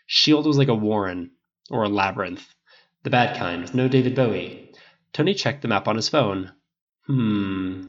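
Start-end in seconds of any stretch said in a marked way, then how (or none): none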